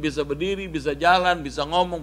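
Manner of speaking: ordinary delivery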